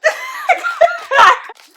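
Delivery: shrieking delighted laugh; loud squealing laugh. Real, not acted. Excited squeal at candy.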